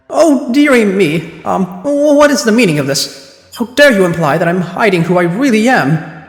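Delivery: a respectable wife clutching her pearls; accused wife protesting to the court, indignant; prim offended lady. Acted. Voices Mrs Geradeb in court.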